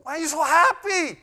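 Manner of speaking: high loud laughing woman's voice; hammed-up sister, high and laughing; imitating the sister. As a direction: play her asking with a laugh. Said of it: "She asked him".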